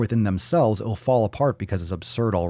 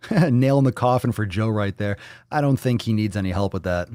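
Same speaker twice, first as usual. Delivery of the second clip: normal talking voice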